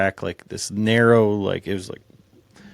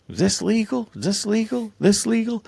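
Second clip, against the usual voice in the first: much higher hammed-up worried whine; mocking Troy; 11 semitones higher than usual. Mocking Troy's nervous repeated question at camp, higher hammed-up whine. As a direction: exaggerate his nervous nagging question. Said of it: "and he's like".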